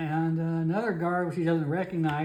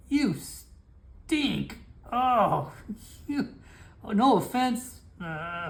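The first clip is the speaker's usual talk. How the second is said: high, gagging outburst at the stink; disgusted guard Felix; 3 semitones higher, slower than usual. Acted. Guard Felix recoiling from Isaac's stench, high disgusted gagging. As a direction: recoil from the smell, voice jumping up.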